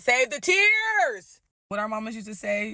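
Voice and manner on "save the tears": high, loud, drawn-out dismissal; exasperated scorn. Retold or imitated. Bride dismisses her fiance's crying, loudly exasperated. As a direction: stretch it out, fed up with the crying.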